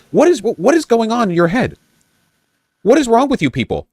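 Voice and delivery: high-pitched incredulous rebuke; aghast at such stupidity; exasperated disbelief. Real, not acted. Exasperated rebuke of the opponent's logic.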